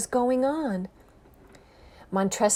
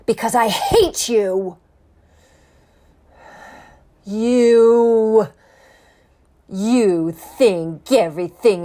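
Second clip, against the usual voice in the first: loud, hateful outburst; furious rant; 6 dB louder than usual. Acted. Montresor shouts his hatred at the chained man, furious. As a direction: spit the grudge at him, louder and louder. Villain rages at his prisoner.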